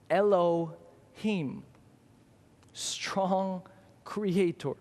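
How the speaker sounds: very slow, hushed and reverent; reverent naming. Acted. Preacher naming God to his church, slow and reverent. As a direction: breathe out each name like a prayer.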